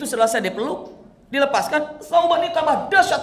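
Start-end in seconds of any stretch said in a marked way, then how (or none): none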